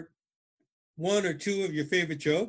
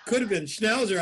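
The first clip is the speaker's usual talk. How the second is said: laughing; 5 semitones higher, 4 dB louder than usual. Real, not acted. Laughing after dirty joke.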